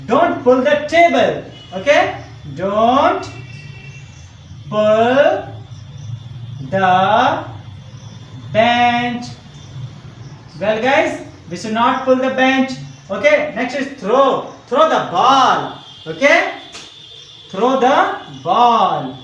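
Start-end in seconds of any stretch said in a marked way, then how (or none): 2.5-9.2 one drawn-out word at a time; very slow, separated words for copying down; slow dictation. Shown as a demonstration. Dictating sentence while writing it. 17.5-19.2 drawn-out words, rising then falling; teacher stretching words for copying; slow dictation. Shown as a demonstration. Dictating sentence while writing it.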